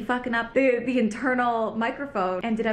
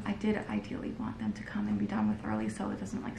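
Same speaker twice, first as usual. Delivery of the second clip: hushed, confiding morning whisper; low-volume, secretive catch-up; 11 semitones lower, 8 dB quieter than usual. Real, not acted. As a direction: whisper as if someone might overhear. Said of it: "I'm whispering as if he's still in here".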